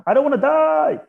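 high, loud panicked cry; panicked ego. Pretend. Ego voiced in panic, pleading not to die. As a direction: blurt it out like the ego terrified of dying.

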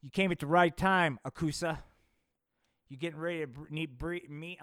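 loud announcer welcome; loud, dramatic greeting; dramatic announcement. Acted. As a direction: boom it out like a trailer voice. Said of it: "gonna make this dramatic".

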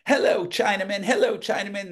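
high singsong Southern greeting; Carl Killingsworth. Retold or imitated. Southern man greets restaurant waiters, imitated high Southern accent. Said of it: "that's how Carl talked".

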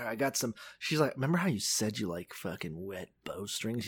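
low, hushed seductive murmur; soft and low, playing at seduction; mock seduction. Acted seduction with candles and jazz.

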